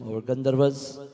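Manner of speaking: normal talking voice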